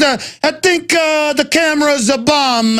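high, slow Bugs Bunny mobster voice; security detail as a hammed-up cartoon gangster. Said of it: "like a Bugs Bunny cartoon with gangsters".

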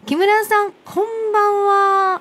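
high, drawn-out phone greeting; sing-out radio hello, stretched at the end; calling out a greeting. Real, not acted. Greeting guest on London phone line.

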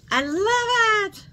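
high, drawn-out burst of delight; thrilled, soaring exclamation; delighted squeal. Real, not acted. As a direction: gush it high and stretched out. Delighted by the gift she opened.

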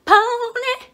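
high, loud squeaky blurt; high squeal. Acted. Host to viewers, squealing the cheeky prompt title.

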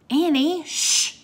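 high warning, then a sharp shush; urgent shushing. A narrator's reading. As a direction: hush a friend who says too much. Jack warns Annie to stop talking.

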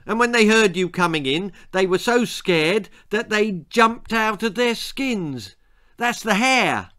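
high, insistent cover story; girl lying. A narrator's reading. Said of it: "lied Esmeralda".

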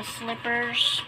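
plain reading voice

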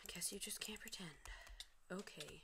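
rueful whisper. Real, not acted. Gamer whispering after being spotted while hiding. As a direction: say it under your breath, resigned.

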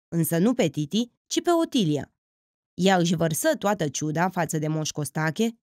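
ordinary delivery, nothing marked

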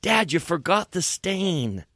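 a hint of an innocent kid; little boy. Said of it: "this is just a little boy".

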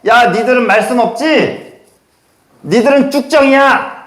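playful defiant cry; defiant shout. Pretend. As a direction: yell it up at the mighty like a nobody with nerve. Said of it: "라고 외치는 거예요".

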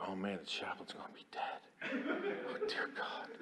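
anxious whisper; whispered prayer. Retold or imitated. Sergeant praying for the chaplain, whispered. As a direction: breathe it like a scared prayer.

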